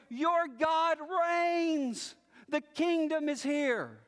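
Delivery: slow, lifted proclamation; triumphant yell. Acted. Watchmen shouting back to the city, slow lifted proclamation. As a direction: cry it back from the walls, drawing out the words. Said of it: "they yell".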